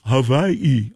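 Hawaii answered with a V, ha-VYE-ee; V pronunciation. Acted. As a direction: say Hawaii as "Havaii". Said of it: "How do you pronounce that?".

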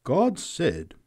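preacher. A narrator's reading. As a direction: start a solemn declaration to the crowd. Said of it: "went on the preacher".

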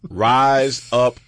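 slow, savoured reading of a slogan; drawn-out slogan. Shown as a demonstration. Host reading the mug's slogan to listeners, drawn out. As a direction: stretch each word slowly, loud on RISE UP. Reads mug slogan out with relish.

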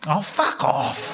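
slow and drawn out, voiced at normal level; drawn-out voiced fuck off; out loud. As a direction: let it slip out loud, dragged out. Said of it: "out loud I just went".

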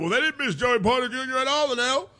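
a high, scoffing skeptic's voice, imitated; mock doubter. Host mimics fans who shrug off the loss, in a high scoffing voice. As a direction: play the doubter waving off the worry, lightly mocking. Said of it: "So you can make the argument".

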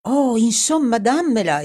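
exclaiming. Acted. Edward to Dorothy, demanding the necklace, loud exasperated exclamation. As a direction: blurt it out, throwing caution away. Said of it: "esclamò Edward buttandosi allo sbaraglio".

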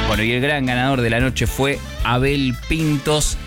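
normal talking voice

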